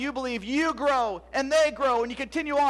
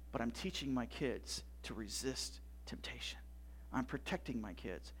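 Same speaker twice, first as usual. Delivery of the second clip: whisper; 11 semitones lower, 16 dB quieter than usual. Real, not acted. Pastor drops to a hush.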